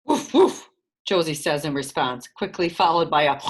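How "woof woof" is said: loud, higher barking woof; playful mock dog bark. A narrator's reading. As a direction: bark like a dog to cover the cat. Josie covers with animal noises.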